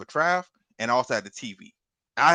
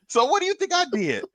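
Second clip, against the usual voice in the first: squeaky high comic question; comic high pitch; 4 dB louder than usual. Acted. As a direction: go way up for the punchline. Rhetorical punchline.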